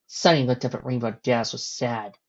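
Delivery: ordinary delivery, nothing marked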